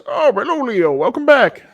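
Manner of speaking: put-on high, loud old gentleman greeting; old man voice. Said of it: "The older gentleman goes".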